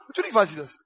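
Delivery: lightly voiced patient God; calm God reply. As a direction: answer as one who knows it all.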